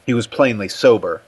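usual speaking voice

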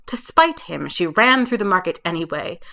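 plain reading voice

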